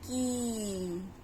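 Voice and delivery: drawn-out, falling की; long ई sound. Shown as a demonstration. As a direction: stretch the ee in की. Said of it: "तो क्या हो जाएगा?".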